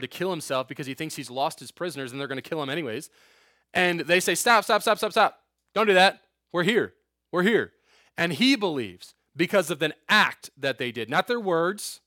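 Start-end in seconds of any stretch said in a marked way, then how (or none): 4.3-7.7 loud, urgent pleading to stop; higher, louder urgent plea; urgent shouting. Retold or imitated. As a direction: call him back from the sword. Stopping jailer's suicide.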